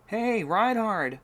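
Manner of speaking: loud, high call, slow at first. Acted. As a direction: call across the courtyard to Reinhard. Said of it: "Jacob called out".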